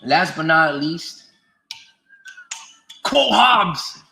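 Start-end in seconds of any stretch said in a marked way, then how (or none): 3.0-4.0 high, slow, loud holler; playful shout. Pretend. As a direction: stretch it out in a big shout. Mock sign-off shout after build-up.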